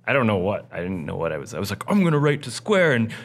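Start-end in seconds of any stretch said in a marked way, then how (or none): 1.9-3.1 high, loud mock-earnest excitement; hammed-up thrill of an overexcited fan; gushing fan. Retelling his vow to write to Square, gushing fan.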